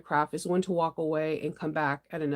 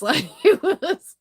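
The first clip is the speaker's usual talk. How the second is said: laughing; 8 semitones higher, 7 dB louder, slower than usual. Real, not acted. Laughing at cicada freakouts.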